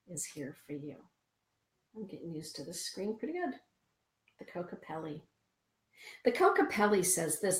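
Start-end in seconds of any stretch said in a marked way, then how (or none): none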